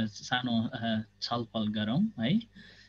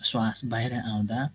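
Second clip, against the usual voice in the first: measured instructions with long silences; guided meditation. Real, not acted. Teacher guiding students to observe the body, guided meditation. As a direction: guide them step by step, pausing between phrases.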